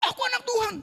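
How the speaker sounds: very high, squeaky mock plea; hammed-up high-pitched claim at the gate; mock protest. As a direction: plead your identity in a comically high voice. Acted reply to angel at gate.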